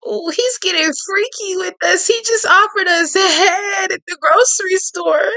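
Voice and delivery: high, squealing excitement; giddy excited reaction. Real, not acted. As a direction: squeal with delight.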